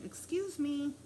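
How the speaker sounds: lightly voiced dad calling a cop over. A narrator's reading. Dad waving over a transit cop, calling out.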